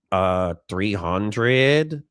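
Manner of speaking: a drowsy engineer quoting a price; unhurried drowsy Mexican drawl; sleepy Mexican accent. Retold or imitated. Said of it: "a sleepy Mexican accent".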